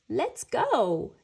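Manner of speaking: loud rallying call; loud, eager cry; excited shout. A narrator's reading. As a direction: shout it to launch the sled. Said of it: "he shouted".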